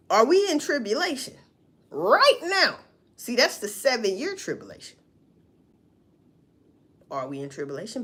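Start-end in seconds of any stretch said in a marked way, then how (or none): 0.1-2.8 "Are we in tribulation right now?" pushed up high and loud; louder pressing question. Real, not acted. Asking viewers pointedly, louder and higher. 7.1-8.0 "Are we in tribulation?" dropped low and soft; quiet musing question. Real, not acted. Wondering aloud to viewers, low and soft.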